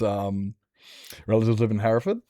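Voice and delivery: ordinary talk, nothing marked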